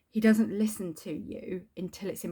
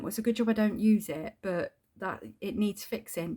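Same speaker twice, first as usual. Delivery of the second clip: normal talking voice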